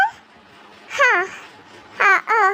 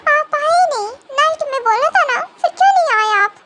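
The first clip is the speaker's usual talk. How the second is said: put-on higher, rising babyish complaint; a little girl upset at being left waiting; childish whining; 4 semitones higher than usual. (बच्चे जैसी शिकायत)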